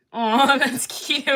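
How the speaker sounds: slow, drawn-out and loud aw with a laugh; fond aw. Real, not acted. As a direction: melt over a sweet comment. Reacting to a sweet comment.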